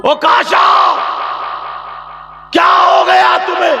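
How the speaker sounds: high, loud, pleading yell; anguished shout. Acted. Companions shouting at Okasha in disbelief, anguished. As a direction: shout at Okasha as if to shake him.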